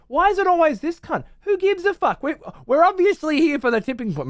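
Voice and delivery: high, loud mocking whine of a bored viewer; mock whiny rant. Mocking the TV show, high and loud. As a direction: ham up the fed-up TV watcher.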